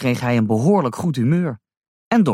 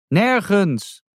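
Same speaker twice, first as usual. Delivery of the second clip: "Nergens" called higher so the deaf raccoon hears; 7 semitones higher than usual. A narrator's reading. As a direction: repeat "Nergens" up high, calling across. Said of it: "riep de neehoorn".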